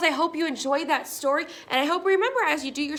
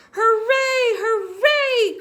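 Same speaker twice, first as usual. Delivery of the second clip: loud, high hooray; cheering; 9 semitones higher, 10 dB louder, much slower than usual. A narrator's reading. Toys cheering at the mountain top. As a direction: cheer at full volume. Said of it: "cried the funny little clown".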